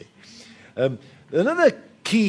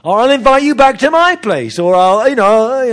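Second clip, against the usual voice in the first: louder, higher, pleading to pay your way; eager pleading offer; 9 semitones higher, 11 dB louder than usual. Retold or imitated. Guest insisting to host, loud pleading voice. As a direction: insist on earning it, much louder. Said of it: "And you say".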